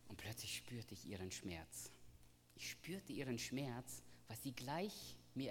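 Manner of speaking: put-on hushed whisper, feeling the child's pain; whispered grief. Father telling the church of his daughter's grief, whispering. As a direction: breathe it out as a sad secret.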